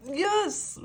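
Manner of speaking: high squeal of triumph; excited yes. Real, not acted. Reader cheering a confirming card, excited. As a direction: cheer like you called it.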